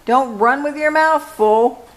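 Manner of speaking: put-on a mother calling after her kid; mock holler. A narrator's reading. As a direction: shout it after him, motherly. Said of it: "hollered Mama".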